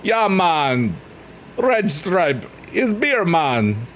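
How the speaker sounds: Jamaican accent. Shown as a demonstration. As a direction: stretch it out in a playful Jamaican accent. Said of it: "what a Jamaican accent sounds like".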